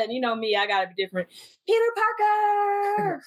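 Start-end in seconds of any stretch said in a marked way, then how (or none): 1.7-3.2 high, drawn-out fan holler; stretched, pitched-up mock cheer; calling out. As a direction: sing the name out at a movie screen. Re-enacts calling out at the screen.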